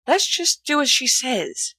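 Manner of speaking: ordinary delivery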